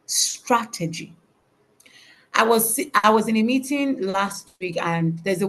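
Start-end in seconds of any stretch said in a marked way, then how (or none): 0.1-1.2 the word Strategy. drawn out slowly with weight; Strategy. spoken slowly and deliberately for emphasis; slow emphatic repeat. Shown as a demonstration. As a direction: land Strategy. slowly, letting it sink in. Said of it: "is called strategy".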